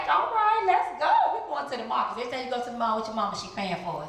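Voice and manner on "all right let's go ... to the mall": eager laughing yes. Retold or imitated. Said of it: "I was like".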